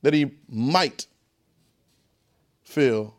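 slow, low, word by word; slow breakdown. Shown as a demonstration. Preacher breaking a verse down for the class, slow and low. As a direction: lay each word down slowly and deeply. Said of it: "We're breaking this thing down now".

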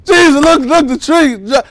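mock amazed disciples tugging at Jesus; excited disciples. Disciples calling Jesus over to the withered tree, loud and amazed. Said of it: "He said".

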